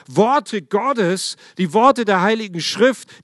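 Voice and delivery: fervent preacher at full volume; preaching. Acted. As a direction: rouse the congregation with God's promises. Sermon climax on God's words.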